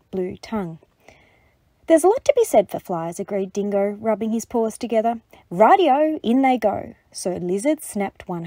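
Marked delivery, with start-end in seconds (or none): 1.8-3.2 eager. Acted. Dingo eagerly agrees. 5.5-6.9 eager. Acted. Dingo's catchphrase.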